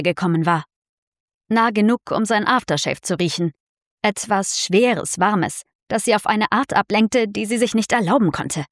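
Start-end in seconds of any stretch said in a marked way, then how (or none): none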